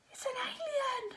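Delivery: high, soft, scared whisper; terrified hush spotting the alien; frightened whisper. Acted. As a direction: breathe it out in fear. Fearful discovery of alien.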